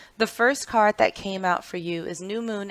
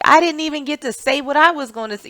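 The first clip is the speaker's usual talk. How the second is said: higher, louder, rushed mock complaint; imitated frustration; 7 semitones higher, 9 dB louder, faster than usual. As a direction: voice someone frustrated at being cut off. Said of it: "left frustrated".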